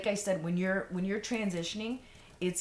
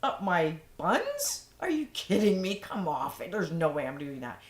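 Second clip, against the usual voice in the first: a retold outraged no way; incredulous refusal; 5 dB louder than usual. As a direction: scoff at the very idea, half laughing. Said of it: "I was like".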